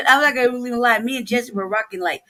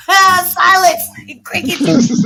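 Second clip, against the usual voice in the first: teasing; 7 semitones higher, 9 dB louder, much slower than usual. Real, not acted. Teasing a quiet friend.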